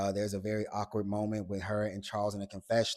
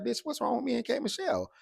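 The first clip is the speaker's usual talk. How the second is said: offended disbelief; 13 semitones higher, faster than usual. Retold or imitated. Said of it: "Shamea's like".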